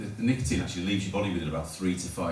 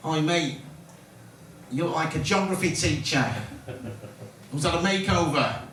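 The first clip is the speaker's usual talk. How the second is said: mock Mancunian accent, higher pitched; Mancunian chav accent; 7 semitones higher than usual. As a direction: imitate a cocky Manchester lad taunting a stranger. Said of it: "Fucking Mancunian chavin".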